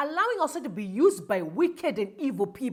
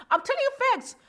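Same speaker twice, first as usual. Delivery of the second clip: high, emphatic insistence; insisting; 10 semitones higher, faster than usual. Real, not acted. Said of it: "insisting on the truth".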